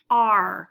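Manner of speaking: R voiced like a pirate's "arr"; pirate-like R sound. Shown as a demonstration. Teacher showing children the sound of a spelling, pirate-like R. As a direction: say R as a full, open "ar". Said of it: "kind of like a pirate".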